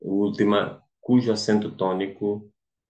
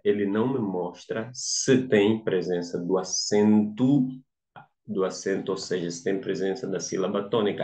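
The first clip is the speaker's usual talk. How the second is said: ordinary delivery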